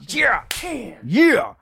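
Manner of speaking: high, loud whoop; excited cheer. Real, not acted. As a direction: whoop it up for the plug. Cheering a plug interlude.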